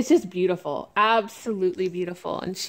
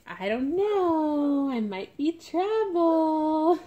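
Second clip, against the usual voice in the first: put-on slow, drawn-out playful baby voice; pretend-coy baby drawl; mischievous baby; 5 semitones higher, slower than usual. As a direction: voice a smirking little troublemaker, stretching the words. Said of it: "little smirk on her face".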